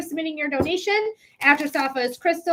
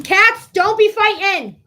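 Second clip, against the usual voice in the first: high, loud and slow call to the cats; scolding the cats; 6 semitones higher, 6 dB louder, slower than usual. Real, not acted. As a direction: break up the cat fight from across the room. Scolding her fighting cats.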